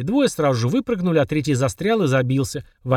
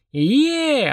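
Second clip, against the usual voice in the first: long, high "yeee" cheer; whooping yell; 11 semitones higher than usual. A narrator's reading. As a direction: whoop with glee on a ride, pitched high. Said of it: "орал Денис". (протяжный восторженный вопль)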